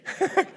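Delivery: short high-pitched laugh. Real, not acted. Preacher amused by a reply from the back, laughing. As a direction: burst out in a brief, high laugh.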